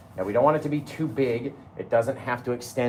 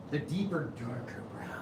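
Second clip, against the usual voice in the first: whispered; 8 dB quieter than usual. Acted. Playful whispered color name.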